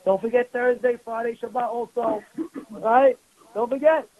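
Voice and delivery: usual speaking voice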